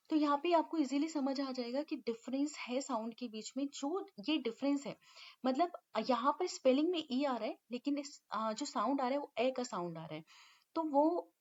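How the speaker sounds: ordinary talk, nothing marked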